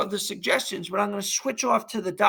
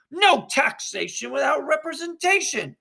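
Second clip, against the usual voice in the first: defiant slogan; 8 semitones higher than usual. Acted. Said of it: "says Spectator Three".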